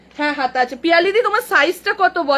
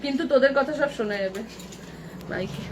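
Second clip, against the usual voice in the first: softer, quick and hushed so the mic misses it; talking softly; 6 semitones lower, 9 dB quieter, faster than usual. Real, not acted. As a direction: keep it down, the mic hears everything. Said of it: "আস্তে আস্তে কথা বলতে হবে".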